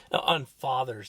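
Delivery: ordinary talk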